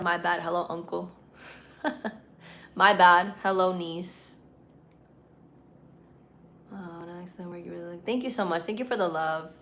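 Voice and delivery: ordinary delivery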